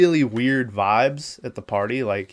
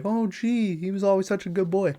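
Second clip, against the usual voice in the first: high, soft, quick mocking voice of oblivious parents; mock clueless parents; 7 semitones higher, 5 dB quieter than usual. Parents who ignore their kid, mocked in a soft high voice. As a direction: parody them as dim and innocent. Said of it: "they're like".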